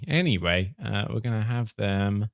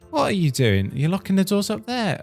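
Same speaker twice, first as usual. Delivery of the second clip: much higher, louder frustrated outburst; exasperated; 5 semitones higher, 6 dB louder than usual. Real, not acted. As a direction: scold the settler, fed up. Settler locks herself in.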